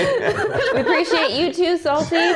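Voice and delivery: high, loud thanks through a laugh. Real, not acted.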